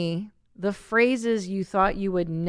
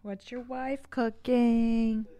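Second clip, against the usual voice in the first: a hint of nosy mom; 3 semitones higher, 4 dB quieter, much slower than usual. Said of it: "come knock on the door".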